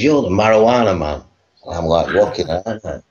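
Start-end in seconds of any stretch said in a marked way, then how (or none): 0.0-1.2 raised pitch, put-on American accent; imitated American accent. American girl identifying the man she recognises, mock American accent. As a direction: mimic the American girl naming him. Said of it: "I have to do this accent".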